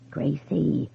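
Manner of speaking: faint, soft voice over a phone line; a dead husband calling from far away; ghostly whisper. A narrator's reading. Dead husband calls his wife faintly over the phone, ghostly.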